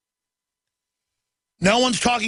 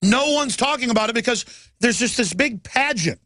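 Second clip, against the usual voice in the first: ordinary delivery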